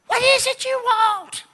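imitating a real shrill preacher, loud and drawn out; Schambach. Preacher turning to a woman for prayer, shrill shout. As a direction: shriek it high like a tent preacher. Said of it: "he had this real shrill voice".

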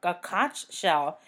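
"conch" said slowly as "kontch"; "conch" with a ch, as in "launch". Retold or imitated. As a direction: draw out "conch", ending on a ch sound. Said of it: "What the hell is a".

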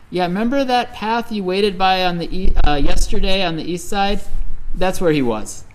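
teasing reply. Retold or imitated. Villagers tease Ben Nen.

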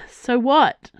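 fighting back tears, voice trembling; voice shaking. Acted. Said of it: "her voice shaking".

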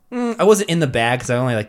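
usual speaking voice

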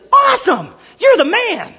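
high, hammed-up cheer; over-the-top excited outburst, imitated; mock elation. Imagined wrong reaction of Peter.

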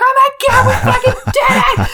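high, excited shout with a laugh; excited team scream. Retold or imitated. Teammates screaming to Harry after the match, high excited shout. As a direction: shriek it like a team that just won. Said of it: "they're screaming".

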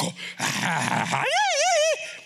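high, drawn-out gleeful cackle and holler; wild fan whooping. Acted. Football fans in the stadium, acted wild whooping. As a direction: let out a giddy supporter's howl.

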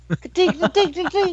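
high clinking ka-tink noise; imitated clinking. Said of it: "Old Prospect is the greatest skit".

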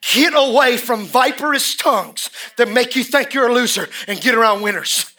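usual speaking voice

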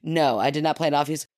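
ordinary talk, nothing marked

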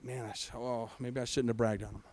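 very low, hushed aside; muttered second thoughts. Acted. Preacher playing God doubting his boast, muttering low.

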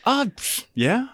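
high, hesitant, halting replay; retold reluctant consent. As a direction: act out awkwardly giving in. Acting out reluctant, awkward consent.

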